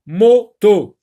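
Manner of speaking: "moto" loud and slow, as "mo... to"; teacher modelling "moto" loudly, beat by beat; sounded-out syllables. Shown as a demonstration. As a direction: split "moto" into two long, strong syllables. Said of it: "Répète le mot".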